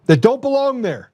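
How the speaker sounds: ordinary talk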